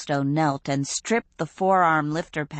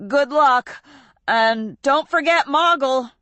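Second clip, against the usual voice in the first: higher, louder call; urgent farewell; 9 semitones higher, 5 dB louder than usual. A narrator's reading. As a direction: shout goodbye into the storm. Hero voiced farewell.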